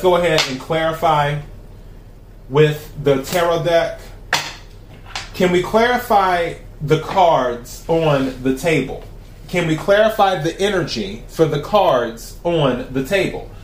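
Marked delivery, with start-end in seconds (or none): 5.3-13.5 invocation. Acted. Invokes tarot deck while shuffling.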